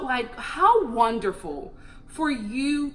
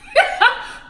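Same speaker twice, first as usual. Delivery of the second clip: burst of laughter; 12 semitones higher, 6 dB louder than usual. Real, not acted. Laughing at dad's reaction.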